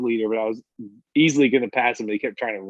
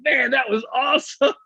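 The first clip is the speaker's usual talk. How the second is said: cheerful dude; 12 semitones higher than usual. Retold or imitated. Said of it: "I'm like".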